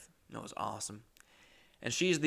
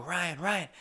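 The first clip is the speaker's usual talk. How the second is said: higher-pitched imitation of a girlfriend calling; lightly voiced girlfriend, pitch raised; girlfriend waking him; 6 semitones higher than usual. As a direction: call a sleeper's name to rouse him. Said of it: "then she would wake me up".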